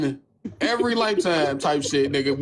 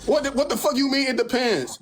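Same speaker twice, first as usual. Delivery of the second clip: pitched-up disbelieving outburst; incredulous; 7 semitones higher than usual. Retold or imitated. Reacting to Elise's answer, high incredulous retort. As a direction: hit it like you can't believe it. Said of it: "I'm like".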